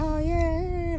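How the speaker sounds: high, airy "oh yeah" of a relative playing innocent; mock relative teasing. Relative brushing off rough play, high mock innocence. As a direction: brush it off lightly, high and breezy. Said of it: "like that, you know".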